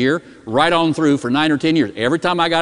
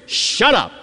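mock yell, pitched higher; retold yell at the dog; 6 semitones higher than usual. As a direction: snap it at the dog like an old man scolding. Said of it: "yelled at the dog".

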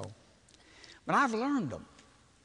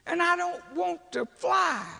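complaining; 12 semitones higher, 3 dB louder than usual. Retold or imitated. Complaining loudly about the trip.

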